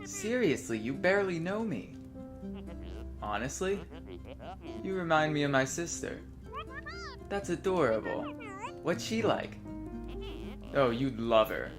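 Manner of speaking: softly reading dialogue lines aloud; reading game dialogue. Acted. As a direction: read the lines like a script. Reads both characters' dialogue.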